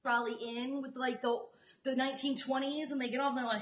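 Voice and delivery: ordinary delivery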